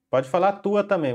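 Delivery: usual speaking voice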